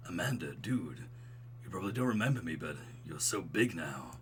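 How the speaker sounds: low, mellow bro voice; smooth and buttery. Acted. Dad's old roommate greeting Amanda, smooth low voice. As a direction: sink into a buttery low register. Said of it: "I'm making him sound like".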